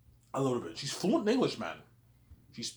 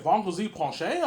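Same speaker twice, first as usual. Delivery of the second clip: imitated echo question, higher and louder; surprised question; 7 semitones higher, 6 dB louder than usual. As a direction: check it back in mock surprise. Retells her surprised echo question.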